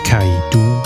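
ordinary delivery

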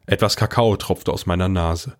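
plain reading voice

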